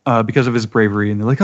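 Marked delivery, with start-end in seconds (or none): none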